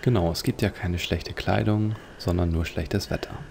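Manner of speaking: ordinary delivery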